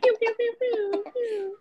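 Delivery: high mock laser pews; laser sound effects. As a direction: fire off playful pew pews. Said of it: "Put the sound effects in there".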